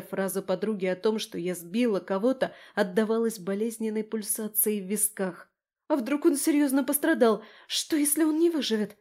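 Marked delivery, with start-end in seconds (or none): 5.9-9.0 high, anxious worrying; panicky cries. A narrator's reading. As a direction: fret aloud in panic. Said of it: "с паническими выкриками Лерки".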